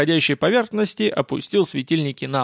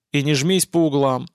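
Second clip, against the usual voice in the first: ordinary delivery, nothing marked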